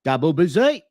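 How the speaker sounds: imitating a Cockney accent; London Cockney twang. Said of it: "Anne Hathaway trying to speak in a Cockney accent".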